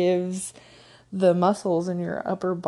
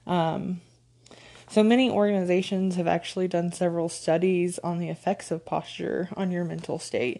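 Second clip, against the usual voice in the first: ordinary talk, nothing marked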